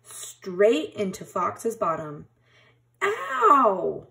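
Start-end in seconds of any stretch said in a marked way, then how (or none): 3.0-4.1 high, louder pained yelp. A narrator's reading. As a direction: fox yelps at a prickle in his bottom. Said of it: "Fox yelped".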